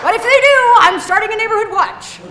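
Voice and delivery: loud, high punchline; mock indignant declaration. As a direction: declare it like an outraged neighbour. Punchline warning to glass-house neighbours.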